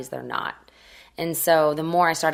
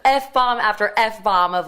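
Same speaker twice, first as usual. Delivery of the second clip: ordinary talk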